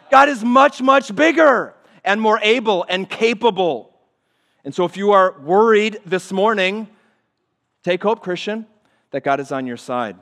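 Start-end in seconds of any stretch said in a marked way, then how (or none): 0.1-8.7 higher, louder, emphatic sermon drive; full-force preaching. Acted. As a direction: press the point home with fire.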